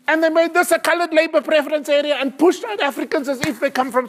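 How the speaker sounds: loud, high furious lecture to the relatives; retold explosion. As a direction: blow up at the family, loud and high. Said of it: "Jonathan is gonna explode".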